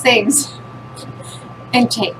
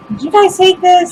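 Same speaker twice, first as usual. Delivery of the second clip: higher, louder mock accusation; lightly acted, high-pitched demand; accusing mom; 8 semitones higher, 5 dB louder than usual. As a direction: imitate a mom questioning the kids. Mom voiced accusing her kids.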